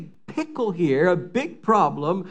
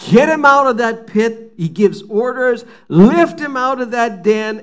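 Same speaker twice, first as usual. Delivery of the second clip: overjoyed orders; 5 semitones higher, 5 dB louder than usual. Acted. Overjoyed king giving orders.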